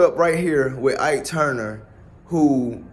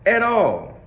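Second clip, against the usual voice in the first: ordinary delivery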